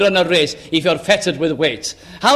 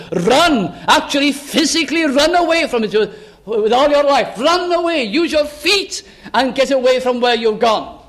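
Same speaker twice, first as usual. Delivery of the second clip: much higher, urgent preaching; urgent command; 6 semitones higher than usual. Acted. Apostle telling the young to flee lust, high and urgent. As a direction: urge them to flee at once. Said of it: "He's speaking like that".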